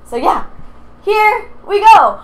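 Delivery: ordinary talk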